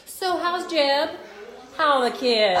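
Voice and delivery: high, loud, drawn-out Southern chattiness; chatty Southern checker. Retold or imitated. Newcomer imitating a grocery checker, high loud Southern chat. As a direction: pitch up and stretch the small talk. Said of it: "the checker would be talking".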